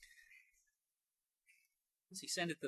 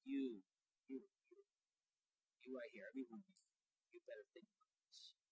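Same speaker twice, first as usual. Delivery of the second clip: much softer, the last line whispered; intimate hush; 4 semitones lower, 15 dB quieter, faster than usual. Shown as a demonstration. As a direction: lean in and whisper to each one. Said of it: "now I wanna say you".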